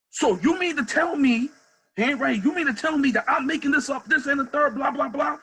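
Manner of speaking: hostile cop. Retold or imitated. Retelling the cop yelling at the coach, mock angry.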